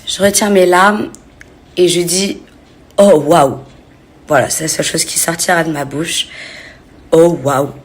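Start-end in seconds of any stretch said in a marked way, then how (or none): 3.0-3.6 louder stunned gasp of a reply; stunned oh wow. Retold or imitated. Stunned reaction on camera. 7.1-7.7 stunned oh wow. Retold or imitated. Repeats her stunned reply.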